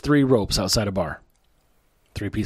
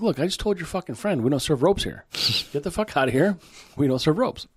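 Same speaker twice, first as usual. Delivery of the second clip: higher-pitched mock exasperation; joke-telling voice, pitched up in annoyance; fed-up bartender; 7 semitones higher than usual. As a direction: play an irritated bartender turning a customer away.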